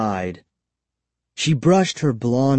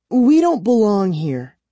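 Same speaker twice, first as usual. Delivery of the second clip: put-on pleading girl; 10 semitones higher, 4 dB louder than usual.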